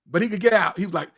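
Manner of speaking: ordinary talk, nothing marked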